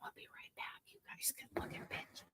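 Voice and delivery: whispered aside to viewers; hurried whisper to the audience. Real, not acted. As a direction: breathe it quickly, unvoiced. Stepping away, whispering to viewers.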